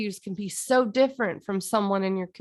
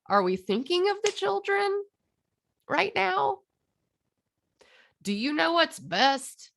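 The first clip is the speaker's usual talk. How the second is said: much higher, mock-alarmed voice; mock pearl-clutcher; 9 semitones higher than usual. Mocking people who fret about the children, high mock-alarmed voice. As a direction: ham up a fretful busybody.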